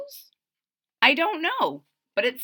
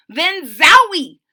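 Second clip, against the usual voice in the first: loud, drawn-out comic-book burst; high, loud storybook exclamation; exclaimed zowie; 9 semitones higher, 15 dB louder, slower than usual. A narrator's reading. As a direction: punch it out like a sudden zap. Exclamation in story read aloud.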